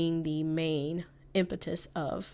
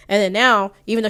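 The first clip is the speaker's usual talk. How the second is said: much louder, stressing the turn; emphatic; 3 semitones higher, 12 dB louder, faster than usual. Real, not acted. Commentator stressing the contradiction, loud and emphatic. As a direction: lean hard into it.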